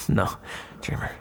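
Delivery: breathy chuckle, almost whispered; amused hushed dismissal; soft laughing no. Acted. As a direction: laugh it off gently, barely voiced. Laughing off a silly suggestion.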